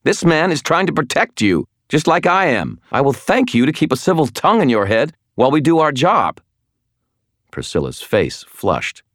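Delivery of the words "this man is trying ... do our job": furious. Acted. Furious tirade at Priscilla.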